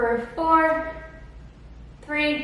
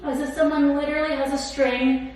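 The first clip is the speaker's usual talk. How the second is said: calm cool-down guidance; 6 dB quieter than usual. Acted. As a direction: ease them into the stretch. Leads the cool-down stretch.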